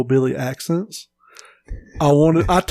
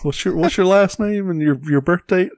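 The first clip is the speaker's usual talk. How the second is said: imitated parents asking, pitched a bit higher; wary parents; 6 semitones higher than usual. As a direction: lightly voice suspicious parents. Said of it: "They were like".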